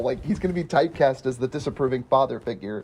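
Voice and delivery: ordinary delivery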